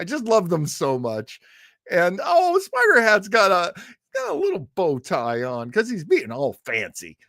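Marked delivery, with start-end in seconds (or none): none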